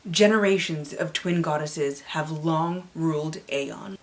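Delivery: ordinary talk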